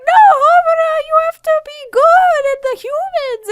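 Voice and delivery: put-on very high, loud girly plea; high-pitched Madoka voice. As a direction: squeal it like an anime heroine. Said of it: "she'd be like".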